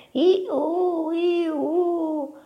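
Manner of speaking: very high, drawn-out "ee-oo"; owl hoot. A narrator's reading. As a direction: imitate a night owl calling. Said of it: "udì qualcuno che chiamava". (verso acuto della civetta)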